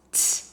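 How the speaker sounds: "T" as a short, loud, isolated t burst; crisp t sound. Shown as a demonstration. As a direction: pop the "T" out with a clear release. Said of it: "do the t sound for me like this".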